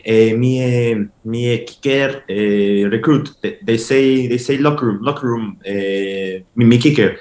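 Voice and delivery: broken English with eh's in a put-on Mexican accent; fake Mexican accent. Asking a football player for the locker room in a fake Mexican accent. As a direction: stumble through it like a Spanish speaker in a Mexican accent. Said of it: "in character".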